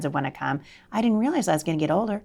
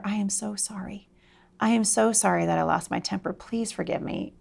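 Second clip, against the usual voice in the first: hushed, earnest retold apology; soft apology; 2 semitones higher, 5 dB quieter than usual. Mom to daughter after losing her temper, soft apology. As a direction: apologize softly and sincerely.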